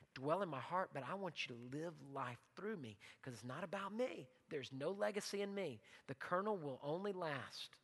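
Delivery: soft, low, intimate prayer voice; quiet prayer. Acted. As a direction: pray it gently, almost to yourself. Prays softly.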